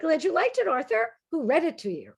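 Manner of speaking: a light, sweet-sounding zinger; sweet comeback. Retold or imitated. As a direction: toss it back with sugary innocence. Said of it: "She said".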